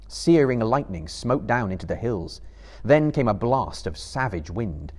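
ordinary delivery, nothing marked

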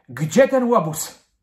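loud, high, slow demand; indignant shout. A narrator's reading. Girl hunting the rascal with a slipper, shouting. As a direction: shout it out, stretching the words. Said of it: "krzyknęła Madzia".

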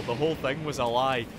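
normal talking voice

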